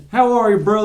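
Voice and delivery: high-pitched mock Southern greeting; Southern accent. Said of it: "in a Southern accent".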